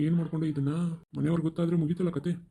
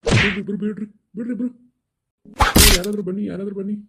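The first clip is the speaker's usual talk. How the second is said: raised, urgent cry for help; urgent calling out; 4 semitones higher, 7 dB louder than usual. Acted. Bystander begging people to break up a college fight, urgent calling out. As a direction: call out to stop a fight.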